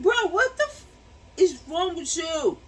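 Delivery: high, loud, shocked outrage at her husband; outraged yell. Retold or imitated. Wife yelling at husband who smacked her butt, outraged. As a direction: yell it like you can't believe he did that.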